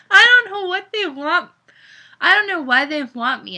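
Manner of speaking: high, loud, acted upset; starting to cry. A narrator's reading. Mariana confessing to Caleb near tears, acted crying. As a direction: plead as though tears are coming. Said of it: "she started to cry".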